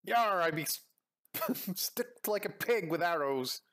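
drawn-out pirate voice, half laughing; hammed-up wounded sea-dog; pirate complaint. Pirate stuck with arrows griping to crewmate, hammed-up pirate.

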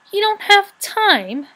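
high, loud, drawn-out incredulous question; playful mock astonishment; mocking echo. A narrator's reading. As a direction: tease by throwing the words back slowly. Brownie mocks the rat's words.

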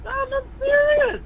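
insistent. Real, not acted. Caller insists.

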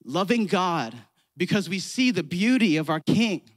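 rising to a loud, fervent preach; preaching climax. Acted.

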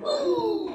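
high pretend hug coo; hugging sound. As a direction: coo as if squeezing a child tight. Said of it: "that was me hugging you".

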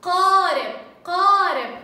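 قارب said loud with a hard initial qaf; stressed qaf. Shown as a demonstration. Teacher stressing qaf in a picture word, loud and firm. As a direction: punch the qaf at the start. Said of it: "أنطق بها بصوت القاف".

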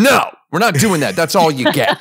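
fast, curt no, not in character; curt brush-off in a normal guy voice. Retold or imitated. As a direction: brush the fan off quickly. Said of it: "like, a normal guy voice".

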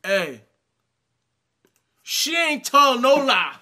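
ordinary talk

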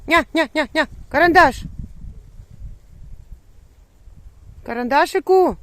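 high, loud call across the yard; calling the dog. Real, not acted. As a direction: call your dog from far away. (голосно кличе собаку)

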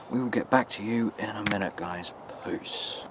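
whispered sign-off, fading out; nervous night vlog whisper. Real, not acted. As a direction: drop to a whisper to say goodbye. Signing off quietly.

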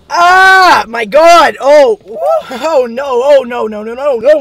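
loud high cries of pain; pained shouting. Real, not acted. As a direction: yell as the heat hits. Ghost pepper burning mouth.

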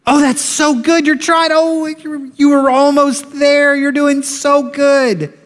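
put-on high, bright cheering of a toddler's try; a parent cheering a toddler, high and thrilled; delighted praise. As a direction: gush with joy over a baby's steps. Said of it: "hear that voice".

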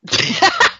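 high squeal of a laugh; sudden high laugh; high burst of laughter. Real, not acted. Laughing at the quiz question.

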